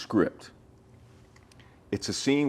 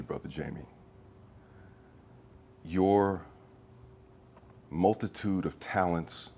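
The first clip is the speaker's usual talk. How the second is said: sincere message; 3 semitones lower, 5 dB quieter than usual. Real, not acted. Actor sends a get-well wish to Jamie, soft and sincere. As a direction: speak gently, straight to a friend who's ill.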